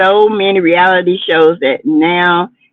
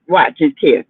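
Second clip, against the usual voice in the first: ordinary delivery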